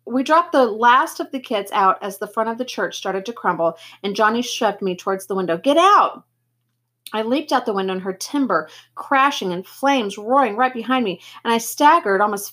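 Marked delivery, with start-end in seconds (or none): 5.6-6.2 high, loud, sudden order to escape; raised-voice cry of warning; urgent shout. A narrator's reading. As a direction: shout it as a desperate command. Johnny shoves narrator toward window.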